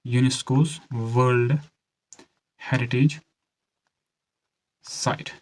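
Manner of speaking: one chunk at a time, long pauses; measured delivery with gaps between words; slow dictation. Shown as a demonstration. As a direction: dictate slowly for students taking notes. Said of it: "धीरे से बोल रहा हूँ".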